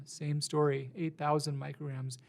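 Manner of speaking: ordinary delivery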